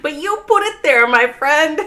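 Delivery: high, loud, playful mock scolding; mock teasing. Teasing a chatter whose name he put there, in a high, loud mock-scolding voice. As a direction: wag a finger at him, jokingly.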